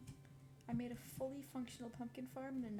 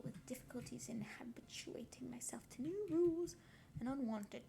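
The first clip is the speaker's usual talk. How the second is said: whispered reading; 2 semitones lower, 9 dB louder than usual. Real, not acted. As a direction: whisper the passage gently, like ASMR. Whispered reading of Jane Eyre.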